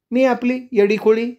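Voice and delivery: high, odd voice of a madwoman; crazed woman. Acted. The mad woman tells him she has no village, high and strange. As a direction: sound unhinged, half laughing.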